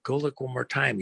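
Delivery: calm the frightened servant down; calm reassurance. Retold or imitated. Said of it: "Elijah says, "Calm, relax".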